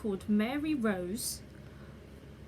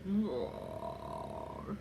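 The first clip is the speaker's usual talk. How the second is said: deep growly bear roar; 4 dB quieter than usual. Pretend. Imitates the bear's growl.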